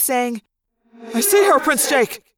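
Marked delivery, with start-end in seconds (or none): 1.1-2.2 echoing telepathic voice; a voice ringing in the head from far off; distant thought-speak. A narrator's reading. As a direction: reverberant mental call. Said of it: "like it was from far off, a thought-speak voice".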